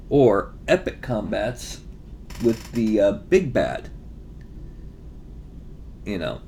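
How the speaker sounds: ordinary delivery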